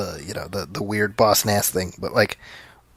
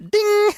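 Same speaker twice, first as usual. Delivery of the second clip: mock bell ding. Cohost pretending the accent tracker scored, with a high mock ding.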